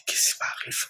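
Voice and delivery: whispered, crying question; frightened sick man whispering through tears; tearful whisper. Retold or imitated. Retelling his heart attack distress.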